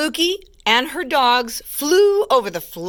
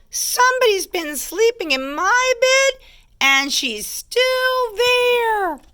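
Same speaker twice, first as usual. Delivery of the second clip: very high cub squeal, drawn out at the end; astonished baby bear squeak; high squeaky baby bear; 12 semitones higher than usual. A narrator's reading. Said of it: "squeaky little voice".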